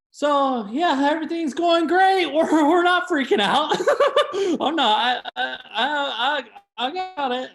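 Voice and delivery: high, loud, laughing panic; nervous laughing babble; mock panic. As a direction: pretend everything is fine while freaking out. Frantic mock calm, laughing.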